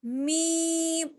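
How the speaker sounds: loud letters, with جیم and میم held long; teacher letter drill. Shown as a demonstration. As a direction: call out each letter for the class. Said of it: "کیسے ادا کرنا ہے".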